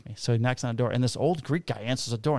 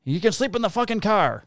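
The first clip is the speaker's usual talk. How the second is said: high-pitched mock order; imitated indignant father; 9 semitones higher, 3 dB louder than usual. Old Greek father sending his son to the car, mock-indignant.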